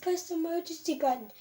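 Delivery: high, pleading wail at Daddy, slowed and near tears; tearful plea. Retold or imitated. Scared kid stuck in the elevator, begging Daddy to call for help, high and tearful. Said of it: "I was, like, starting to burst into tears".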